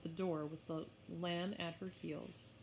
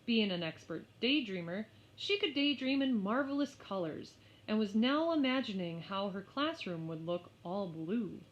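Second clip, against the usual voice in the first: usual speaking voice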